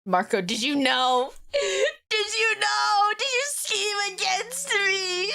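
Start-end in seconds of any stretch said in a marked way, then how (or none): 1.5-5.3 high, drawn-out wail of betrayal; hammed-up high-pitched bawling; mock crying. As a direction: sob the accusation, breaking into a howl. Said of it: "let me cry real quick".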